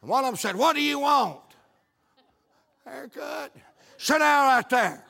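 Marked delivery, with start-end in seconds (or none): 0.6-1.4 loud, higher-pitched mock demand; bossy barber putting a customer on the spot; gruff demand. 2.8-3.5 small, soft, higher voice; cowed customer's little voice; timid reply. Retold or imitated. Timid customer answers barber. 4.0-5.1 loud, raised-pitch command; mock drill-sergeant barber; bossy order. Barber orders customer about.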